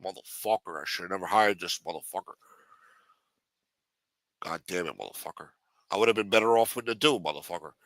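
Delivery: put-on low rasping old mafia boss; low mob boss. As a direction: whisper threats like an aging don. Boss grumbles to himself.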